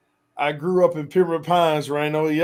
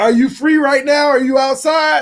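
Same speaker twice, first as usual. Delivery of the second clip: eager call to a friend who might be outside; 8 semitones higher than usual. Real, not acted. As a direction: hail him like he's far away. Calling out to Jazza on stream.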